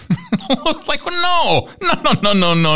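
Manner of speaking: high, laughing no no no; dad laughing as he refuses the child. Retold or imitated.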